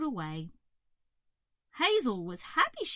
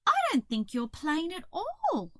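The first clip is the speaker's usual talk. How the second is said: higher, louder caterpillar voice; reassuring Hazel; 6 semitones higher, 5 dB louder than usual. A narrator's reading. Hazel cheering up the cockroach, reassuring. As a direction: protest it kindly, pitched up.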